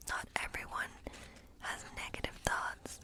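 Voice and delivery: normal talking voice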